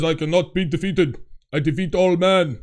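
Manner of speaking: mock-Russian film fighter voice; Drago. Host quoting the film after Drago's fight, put-on fighter voice. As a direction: play the foreign boxer, declaiming. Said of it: "when he beats Drago, he says".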